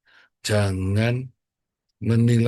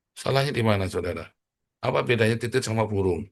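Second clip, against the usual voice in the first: normal talking voice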